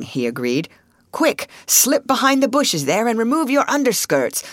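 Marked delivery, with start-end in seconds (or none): 1.1-4.4 higher, brisk-sounding instruction; urgent order. A narrator's reading. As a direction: hurry them along. Father gives urgent orders.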